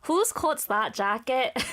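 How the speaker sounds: slow, drawn-out Welsh accent. Shown as a demonstration. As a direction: show off a practised Welsh accent, slowly. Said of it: "you can do the Welsh accent".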